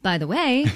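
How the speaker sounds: BY THE WAY lifted high, falling at the end; belabored take. Shown as a demonstration. Host retrying one line, belabored take. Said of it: "we belabor each take".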